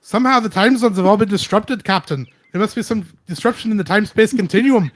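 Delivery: loud Scottish engineer warning the captain; Scotty Scottish accent. Pretend. Scottish engineer shouts his warning to the captain in Scottish accent. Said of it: "my Scotty from Star Trek".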